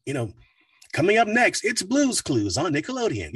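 put-on high, bright kids' channel promo; upbeat announcer. Shown as a demonstration. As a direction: sell it with a big smile. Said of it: "be more upbeat".

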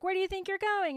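lightly acted indignant teacher; mock stern teacher. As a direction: imitate a teacher catching a pupil. Teacher challenging pupil in retold scene.

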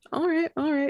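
smooth cabin announcement voice; like a flight attendant on the intercom. Pretend. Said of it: "You sound like a flight attendant".

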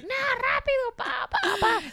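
high, hurried boss voice; mock the boss rushing her; pushy boss snapping at the slow cook; impatient boss. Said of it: "el jefe así de que".

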